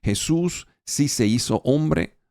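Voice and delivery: ordinary talk, nothing marked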